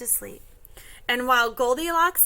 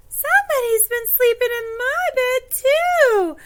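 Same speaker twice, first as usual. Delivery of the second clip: high, loud mother bear voice; Mama Bear; 12 semitones higher, 6 dB louder than usual. Acted. Seeing her bed slept in, high dismayed mother bear. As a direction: lift it high in storybook dismay. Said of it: "And Mama Bear says".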